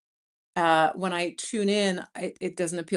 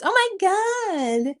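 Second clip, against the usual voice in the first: gushing travel influencer; 9 semitones higher than usual. Retold or imitated. Vlogger mocking shallow travel videos, in a high gushing voice. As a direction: parody a breathless travel vlogger raving. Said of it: "not just".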